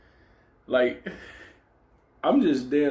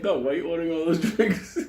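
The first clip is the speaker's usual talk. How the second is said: laughing; 6 semitones higher than usual. Real, not acted. Laughing while quoting himself.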